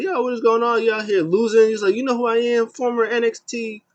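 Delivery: high, loud mock rant; hammed-up angry tirade listing his titles; Kalisto yelling. Kalisto yelling at Lindsay Dorado after losing, high loud mock rant. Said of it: "he's yelling at Lindsay Dema".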